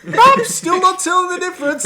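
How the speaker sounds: mock frustrated taster, high and loud; baffled squeak. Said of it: "I'm going".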